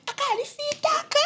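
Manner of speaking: high-pitched mock relative's jibe; teasing relatives. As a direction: imitate relatives remarking in a high voice. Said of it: "they'll be like".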